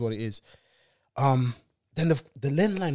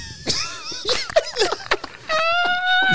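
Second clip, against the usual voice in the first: high shrieking laugh. Real, not acted. Laughing at the mum's threat.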